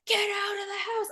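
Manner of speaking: high urgent command; firefighter voice. Retold or imitated. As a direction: order them out like a firefighter. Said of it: "It's like the firefighter voice".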